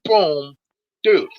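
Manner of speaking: ordinary delivery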